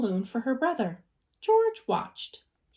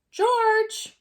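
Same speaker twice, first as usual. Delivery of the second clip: calling out; 11 semitones higher than usual. A narrator's reading. The man calls to George.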